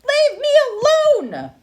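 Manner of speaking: very high, very loud fed-up yell; old woman shouting at curious bears; shouted. Acted. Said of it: "the old woman shouted".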